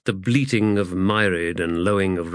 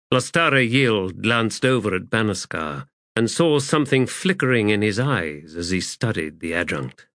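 ordinary delivery

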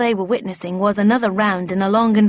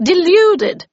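high, loud dismissal; scornful retort; 11 semitones higher, 10 dB louder than usual. A narrator's reading. Havisham dismisses the Red Queen's charge, scornfully. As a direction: throw it back with force. Heated quarrel, Havisham snaps back.

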